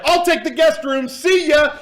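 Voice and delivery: very high, loud hurried-exit yell; gleeful grab shout. Retold or imitated. Executive claiming the only guest room, shouting his exit. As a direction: shout it as you bolt for the door.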